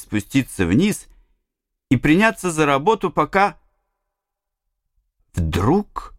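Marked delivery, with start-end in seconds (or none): none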